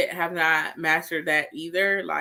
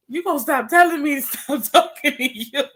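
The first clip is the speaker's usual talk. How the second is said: laughing mock retort; 6 semitones higher, 3 dB louder than usual. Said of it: "And I was just like".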